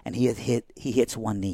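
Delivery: ordinary delivery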